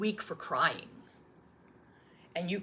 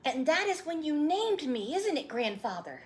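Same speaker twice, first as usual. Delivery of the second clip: put-on high, eager child voice; a little boy pleading for the story; young boy; 11 semitones higher than usual. Boy asking his grandfather to retell his birth, eager child voice.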